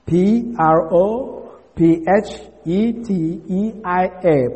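letters spelt out one by one; spelling aloud. Shown as a demonstration. Teacher spelling the Greek word for the class, letter by letter. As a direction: read each letter of the Greek word clearly. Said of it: "spelling the word aloud".

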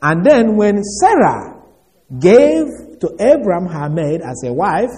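normal talking voice

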